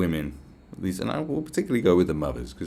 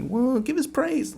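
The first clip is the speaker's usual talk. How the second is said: high, slow, drawn-out begging for credit; mock plea for praise; slower than usual. Mocking NHS workers fishing for praise, high and slow. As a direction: stretch it out like a needy show-off. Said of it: "You, like".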